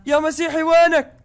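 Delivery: calling out. Acted. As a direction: shout to find your friend.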